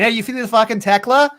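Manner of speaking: hammed-up Boston bro asking about the Tekla; Boston accent. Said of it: "It sounds like us with a Boston accent".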